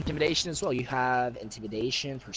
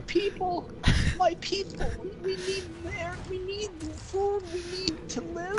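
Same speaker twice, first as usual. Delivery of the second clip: high strained sobbing plea; high crying plea. Acted. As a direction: beg in a high weeping voice. Orc warlord pleading, crying.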